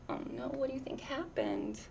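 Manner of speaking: hushed suspense whisper. Real, not acted. Asking the children what happened, in a hushed whisper.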